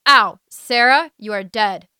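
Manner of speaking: angry yell. Acted. Crawdad flung onto Sammy's ear.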